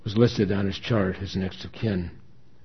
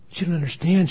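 high, louder plea; pleading; 8 semitones higher, 5 dB louder, faster than usual. A narrator's reading. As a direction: plead to be understood. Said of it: "she pleaded".

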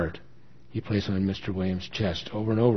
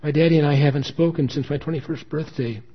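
pleading explanation; 6 semitones higher, 5 dB louder than usual. A narrator's reading. Daughter pleading over estrangement.

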